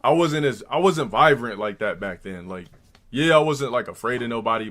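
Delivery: normal talking voice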